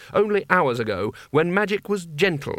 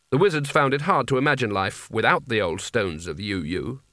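plain reading voice

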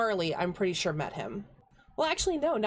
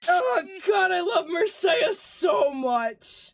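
loud, high, squealing over the character, half laughing; gushing delight; 11 semitones higher, 6 dB louder, much slower than usual. Real, not acted. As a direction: shout out pure fan delight. DM gushes over own character.

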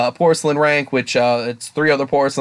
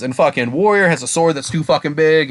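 ordinary delivery

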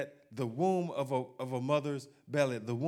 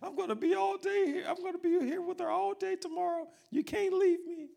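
much higher pleading wife voice. Retold or imitated. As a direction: beg him not to go to sleep, high-pitched. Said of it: "Tomorrow's like".

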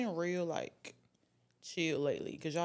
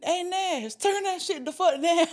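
put-on high, loud Jamaican accent, laughing through it; laughing Jamaican DJ shout-out; 11 semitones higher, 8 dB louder than usual. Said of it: "He said it in a Jamaican accent".

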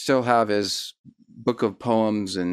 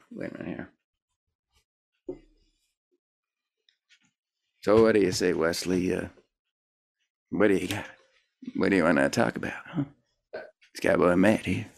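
Cowboy Matt; 4 semitones lower, 4 dB quieter than usual. Pretend. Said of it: "I got this character named Cowboy Matt".

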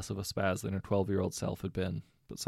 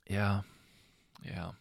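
unhappy. A narrator's reading. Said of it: "said Bill unhappily".

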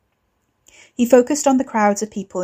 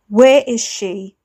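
angry demand; 3 semitones higher, 7 dB louder, slower than usual. Acted. Wes demanding angrily.

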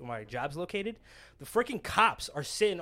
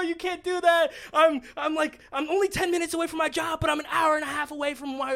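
high, loud, whiny panicked pleading; freaking out; 10 semitones higher, 6 dB louder than usual. Retold or imitated. Driver begging the officer not to tow his car, frantic high-pitched pleading. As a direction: beg frantically in a near-wail, words tumbling out. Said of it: "I was freaking out".

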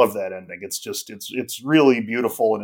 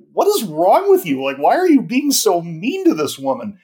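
higher and louder, exasperated disbelief; incredulous outburst; 6 semitones higher, 5 dB louder than usual. Retold or imitated. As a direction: demand an answer, baffled and fed up. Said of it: "And it's like".

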